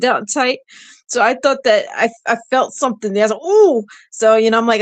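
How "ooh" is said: high, loud "ooh" of surprise; startled ooh. Retold or imitated. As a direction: let out a sudden high ooh. Retelling a sudden twinge.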